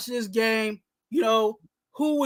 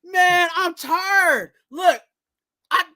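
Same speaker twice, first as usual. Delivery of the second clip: high, drawn-out exasperated cry; performed weary outburst; fed-up rant; 5 semitones higher, slower than usual. Acted. Segment rant at the coach.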